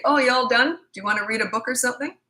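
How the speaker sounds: cheerful parent. Shown as a demonstration.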